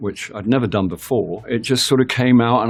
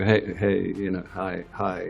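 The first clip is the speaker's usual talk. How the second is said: imitating crew's subdued hellos, trailing off softer; awkward greeting; 7 dB quieter than usual. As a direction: mumble a half-hearted hello in passing. Crew awkwardly greeting him, then leaving.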